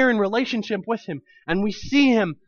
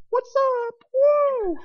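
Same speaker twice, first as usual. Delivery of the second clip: much higher, playful cheer; gleeful whoop; slower than usual. Pretend. Preacher imagining racing past a chariot, gleeful whoop.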